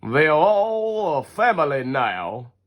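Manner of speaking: slow, solemn preacher announcement; preacher's voice. Acted. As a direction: pronounce it slowly like a minister. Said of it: "the preacher finally says".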